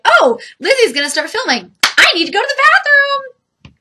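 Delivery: high mock sing-song cat voice. To viewers, voicing the cat timing her bathroom trip, high and mocking. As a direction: squeak it out as the smug cat. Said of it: "She, like, times it, like".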